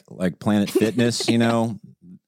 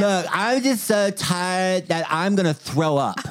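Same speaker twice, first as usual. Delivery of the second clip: higher, slow mock whine; dramatic wife imitation; 8 semitones higher, slower than usual. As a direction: ham up an exhausted complaint. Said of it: "She's been so dramatic lately".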